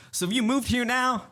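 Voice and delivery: very high mock Texan accent; Texas voice. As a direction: put on a high, nosy Texan local. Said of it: "this is my Texas voice".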